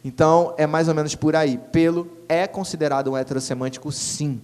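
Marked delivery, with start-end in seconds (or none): none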